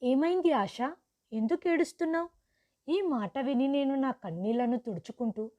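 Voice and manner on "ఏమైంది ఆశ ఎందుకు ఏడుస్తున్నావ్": higher, anxious questions; alarmed mother. Acted. Mother alarmed at her crying daughter, worried. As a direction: ask in a worried rush. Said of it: "అమ్మ కంగారు పడిపోయి ఇలా అడిగింది".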